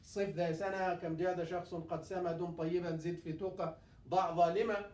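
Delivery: rapid rhyming recitation; fast memorization chant; fast recited line. Shown as a demonstration. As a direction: rattle off the memory line quickly. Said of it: "there is one line".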